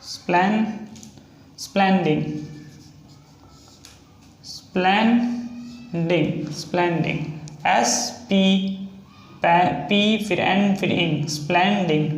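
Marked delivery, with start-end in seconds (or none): 4.4-6.5 drawn out slowly. Shown as a demonstration. Teacher writing स्प्लेंडिंग on the board for students, very slowly. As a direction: say स्प्लेंडिंग very slowly, stretching each syllable. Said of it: "next है splendid".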